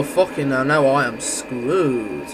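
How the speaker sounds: normal talking voice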